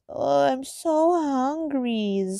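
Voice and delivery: high, slow, drawn-out cutesy whine; mock cutesy hungry whine. Morgan whining about her growling stomach, high, slow and cutesy. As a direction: drag out the so like a cutesy anime girl. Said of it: "she goes".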